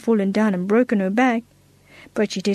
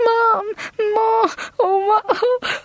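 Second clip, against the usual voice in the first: high, crying calls for her mother; a scared little girl stuck in a tree, wailing; frightened screaming; 11 semitones higher, much slower than usual. Acted. Little girl stuck in a tree screams for Mom, crying. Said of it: "heard screams coming from the back".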